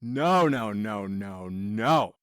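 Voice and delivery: low, drawn-out string of noes; imitating a deep groan of dismay. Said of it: "I was like".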